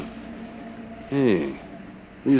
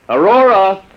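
louder call up high; 7 semitones higher, 4 dB louder, slower than usual. Acted. Husband calling his wife over the boarder, loudly.

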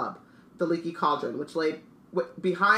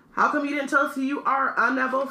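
high, eager character voice; excited Stan; 9 semitones higher, 4 dB louder than usual. Acted. Stan asks Harry why he hid his name, high excited voice. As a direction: pitch it up, louder, delighted.

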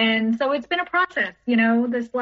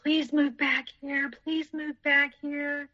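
slow, soft, drawn-out pleading; mock begging, each word stretched and softened; imitated begging; 4 semitones higher, 5 dB quieter, slower than usual. Ex begging her to move back, slow mock pleading.

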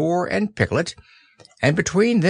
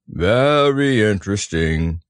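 Eeyore voice; 2 semitones lower, slower than usual. Acted. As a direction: drag it out mournfully. Eeyore speaking.

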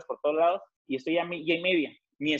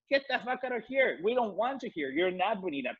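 pitched-up mock Karen rant; Mexican Karen; 6 semitones higher, 4 dB quieter than usual. Mocking his own rant as a Mexican Karen, pitched up. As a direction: ham up an entitled Karen scolding. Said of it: "Mexican Karen version".

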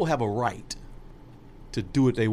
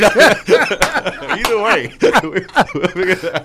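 loud laughing, pitched up; 8 semitones higher, 11 dB louder, slower than usual. Real, not acted. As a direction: laugh through the words.

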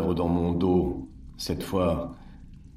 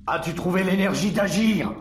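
rising, louder, accusing demand; angry challenge; 10 semitones higher, 4 dB louder than usual. Acted. Caesar taunting his visitor, angry raised voice. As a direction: throw the question in his face.

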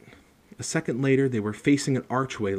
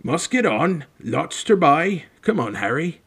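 loud, raised big-man voice; calling over babble; 6 semitones higher, 7 dB louder than usual. A narrator's reading. Hagrid hurries Harry out of the crowd, calling loudly. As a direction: make yourself heard across a noisy room. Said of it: "make himself heard over the babble".

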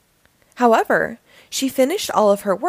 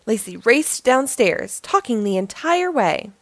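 ordinary delivery, nothing marked

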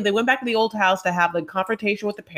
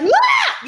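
very high, loud open wah; long open-mouth vowel scream; scared wail; 6 dB louder than usual. Retold or imitated. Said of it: "it's wah".

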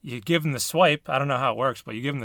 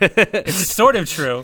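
slow drawn out; 3 semitones higher, 5 dB louder, much slower than usual. Real, not acted. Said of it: "That's why I said false".

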